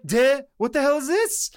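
high-pitched, baffled exclamation; astonished. Retold or imitated. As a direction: blurt it in high-voiced disbelief at the sight. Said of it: "and he's like".

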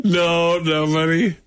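ordinary delivery

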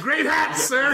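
very high-pitched imitated flattery; mock enthusiastic compliment. Quoting flatterers of the crummy hats, high mock enthusiasm. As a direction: send the pitch way up in playful praise. Quotes characters hailing the hats.

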